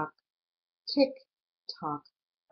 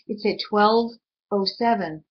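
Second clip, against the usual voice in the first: ordinary delivery, nothing marked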